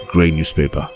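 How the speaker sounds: ordinary delivery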